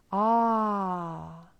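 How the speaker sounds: AH sustained on one long breath; long, resonant-vowel demo of "Ah"; open long A. Shown as a demonstration. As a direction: let a wide-open "Ah" ring out and fade. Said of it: "can you feel when you say ah".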